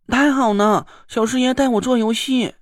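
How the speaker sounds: put-on high childlike doll cheering; babyish cheer. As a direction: cheer like a delighted toddler. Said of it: "泥娃娃奶声奶气地欢呼起来". (奶声奶气地欢呼)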